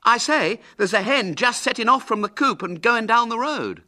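high, loud call across a field. A narrator's reading. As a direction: shout the sighting to your friends. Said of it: "called Ginger from the stile".

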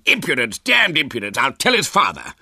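loud, high blustering general; outraged shouting. A narrator's reading. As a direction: explode with indignation like an old general. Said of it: "General Malt had found his voice and was shouting".

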